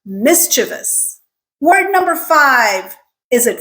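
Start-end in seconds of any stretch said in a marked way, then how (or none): 0.1-1.2 mischievous slowly as MIS-chuh-vus; correct, careful. Shown as a demonstration. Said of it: "We can do it".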